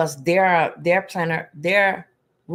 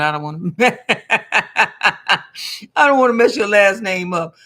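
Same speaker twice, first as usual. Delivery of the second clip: laughing through the words, louder and higher; 3 semitones higher, 7 dB louder than usual. Real, not acted. Numerology reader to viewer, laughing about her name. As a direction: giggle it out, embarrassed.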